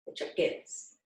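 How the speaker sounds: hushed, whispered repeat. Real, not acted. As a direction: let them in on it in a whisper.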